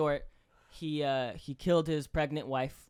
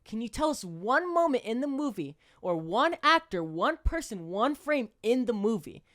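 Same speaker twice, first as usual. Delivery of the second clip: loud, slow, pressing cop grilling a suspect; mock cop interrogation; 5 semitones higher, 7 dB louder, slower than usual. Cop grilling the suspect about the movie, loud and slow. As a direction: bear down on him louder and higher, word by word.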